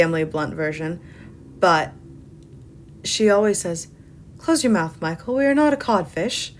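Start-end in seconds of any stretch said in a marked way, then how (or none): 4.4-6.5 put-on higher-pitched, little British accent; lilting British accent, pitched up; Mary Poppins. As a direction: imitate a prim British nanny's little accent. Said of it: "in her little British accent".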